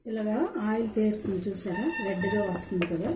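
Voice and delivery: ordinary delivery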